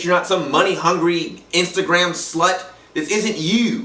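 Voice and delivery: normal talking voice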